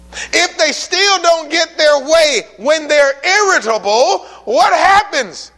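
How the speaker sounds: high, loud, building to "what happens"; rising preaching shout. Real, not acted. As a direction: preach it at full force, climbing.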